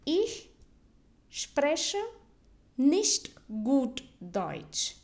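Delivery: slow German, each of "Ich", "spreche", "nicht", "gut", "Deutsch" set apart; word by word. Shown as a demonstration. Teacher models the sentence for beginners, slowly word by word. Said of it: "How do you say that?".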